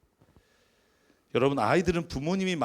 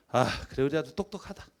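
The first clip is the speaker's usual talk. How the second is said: frowning sarcasm; 3 semitones lower, 4 dB quieter than usual. Shown as a demonstration. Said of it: "인상을 쓰고 혀를 차면서".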